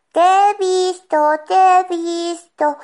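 imitated seeker's call. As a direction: crow it out as the seeker. Brother calls out he spotted them.

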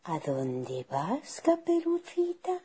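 slow, soft wolf coaxing, leaping high at the end; drawn-out honeyed question; sweet, wheedling voice. Acted. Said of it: "una voz dulce y zalamera".

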